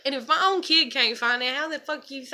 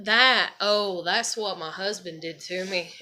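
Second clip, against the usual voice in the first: usual speaking voice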